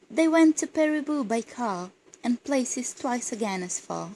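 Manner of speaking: measured, projected verse reading; poem recitation. Acted. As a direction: read the poem aloud, letting each couplet's end fall. Reads a poem aloud.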